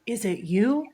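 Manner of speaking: playful question, lifting at the end; joking. Retold or imitated. Hunter joking to her partner about a noise, playfully. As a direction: toss it out as a teasing question. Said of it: "jokingly, without looking".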